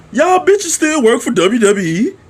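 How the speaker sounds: high-pitched, incredulous question; retold disbelief. Fan to the Usos turning up, retold disbelief.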